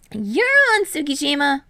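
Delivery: high, loud eager; eager acceptance. A narrator's reading. Hinata accepting Kei's bet, high and eager. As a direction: accept with a grin.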